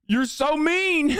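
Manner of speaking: high, slow, whiny mock of a crying troll; mock crybaby whine. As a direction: drag it out in a sniveling baby voice to mock them. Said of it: "they cry. They're like".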